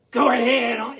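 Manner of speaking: growling, rasping wolf voice; wolves. Shown as a demonstration. Wolves sending Brer Rabbit up to bed, growling. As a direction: growl it out with claws up. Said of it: "and say it like that".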